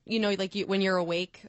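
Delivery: ordinary talk, nothing marked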